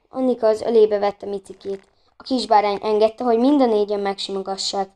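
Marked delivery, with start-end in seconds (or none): none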